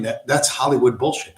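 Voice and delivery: ordinary delivery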